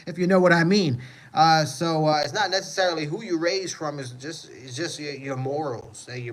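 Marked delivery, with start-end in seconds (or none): 0.0-1.0 laughing. Real, not acted. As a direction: burst of high laughter into a knowing aside. Jokes about dark calles.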